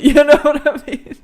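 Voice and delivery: loud, high, through laughter; real giggly check-in with the listener; laughing. Real, not acted. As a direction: laugh your way through the question. Laughing at own remark.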